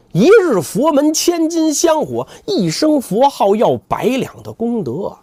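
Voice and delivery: sneering lecture. Acted. 扫地僧讥讽.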